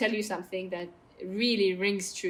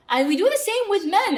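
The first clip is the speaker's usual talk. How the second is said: loud emphasis; 11 semitones higher, 7 dB louder than usual. Real, not acted.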